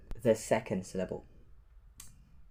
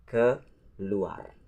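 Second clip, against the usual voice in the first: normal talking voice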